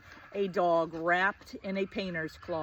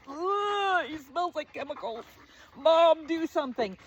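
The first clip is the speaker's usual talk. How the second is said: high, whiny dog-as-snob complaint; disgusted collie; 8 semitones higher, 4 dB louder than usual. Acted. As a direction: play a revolted collie calling for Mom.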